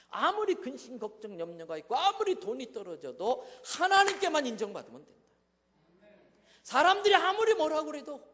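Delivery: loud, rising preacher's cry; loud revival preaching; fervent preaching. Acted. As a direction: proclaim it to the whole church. Preaching at full force.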